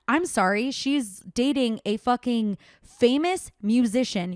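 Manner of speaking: usual speaking voice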